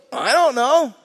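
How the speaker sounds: high, loud mock bafflement; baffled reply. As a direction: throw it up high, imitating someone stumped. Retold man stumped by question.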